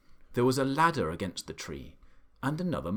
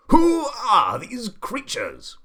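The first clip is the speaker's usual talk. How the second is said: high, very loud, drawn-out roar; Duke bellowing; 12 semitones higher, 10 dB louder, slower than usual. A narrator's reading. Duke about to burst, facing animals, bellowing. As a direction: stretch the question into an outraged bellow. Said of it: "he bellowed".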